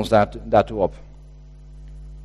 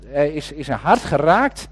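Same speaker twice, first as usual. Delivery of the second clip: usual speaking voice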